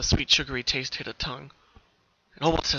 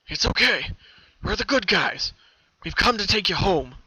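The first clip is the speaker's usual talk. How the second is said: higher, louder friendly reassurance; calm a scared filly; smiling rescuer; 6 semitones higher, 5 dB louder than usual. Acted. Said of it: "he said with a smile".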